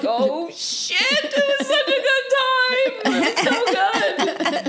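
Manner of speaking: high, slow, laughing delight; laughing nostalgia. Retold or imitated. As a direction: gush through laughter about the good time. Remembering the great night.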